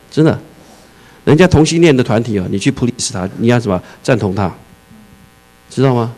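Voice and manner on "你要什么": ordinary delivery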